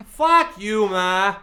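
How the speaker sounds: loud, high Boston shout with laughter behind it; hammed-up Boston outburst; mock Boston accent yell. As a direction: bellow it like a bratty Boston son. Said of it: "in a Boston accent".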